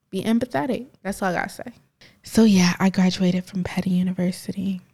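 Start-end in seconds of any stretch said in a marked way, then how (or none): 2.9-4.8 whispered aside, no voice at all. Acted. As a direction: drop to a secret whisper. Whispering joke about Petty University.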